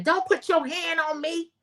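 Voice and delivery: high-pitched retold scream without the volume; mock scream. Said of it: "Tamar is screaming".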